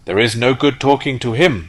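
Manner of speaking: a dismissive little insect; dragonfly. Acted. Dragonfly speaks.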